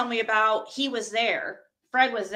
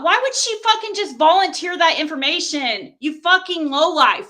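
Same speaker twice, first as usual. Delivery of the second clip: loud, raised-pitch indignant rant; angry outburst; 4 semitones higher, 5 dB louder than usual. Real, not acted. Defending Laura against an accuser, angrily indignant.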